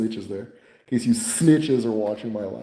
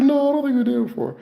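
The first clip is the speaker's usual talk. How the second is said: high, fast denial; imitating the patient insisting; faster than usual. Patient voice in retold argument.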